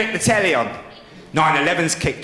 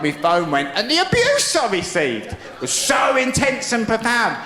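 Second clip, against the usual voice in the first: normal talking voice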